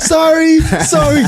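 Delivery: high, frantic apologizing voice; mock apology. Woman caught on the toilet, apologizing in a high panic. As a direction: squeak out a flustered apology. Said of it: "She's like".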